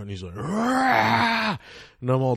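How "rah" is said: a high, loud startled roar; mock freakout yell. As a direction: jolt awake with a wild rah. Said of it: "and he's freaking out".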